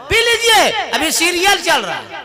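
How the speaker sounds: high brushing-off wife voice; wife brushes off thirsty husband, high and dismissive. Acted. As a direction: wave him off, busy with her serial. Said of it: "wife dismissing husband".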